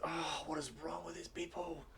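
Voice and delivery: high, frustrated groan of words; exasperated prince. Acted. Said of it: "He says".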